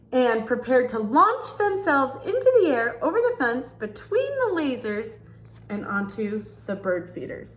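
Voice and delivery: put-on lively read-aloud for children; storybook reading. Reading a picture book to kids, storytelling voice.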